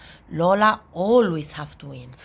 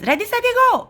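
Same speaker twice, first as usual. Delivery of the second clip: put-on high-pitched little-girl call; excited race start; 4 dB louder, faster than usual. A narrator's reading. Little sister starts race against brother, excited. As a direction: launch the race in a rush.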